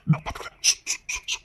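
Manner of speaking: very soft, secretive shushing; whispered hushing. Acted. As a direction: hush them under your breath. Husband hushes crying wife.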